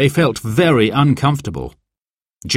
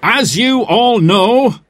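high, slow projected voice; raised public-speaking voice; addressing a meeting; 11 semitones higher, 4 dB louder, slower than usual. A narrator's reading. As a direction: open a speech to a crowded hall. Manager addresses a crowded meeting.

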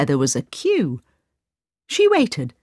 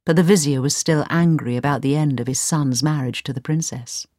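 ordinary delivery, nothing marked

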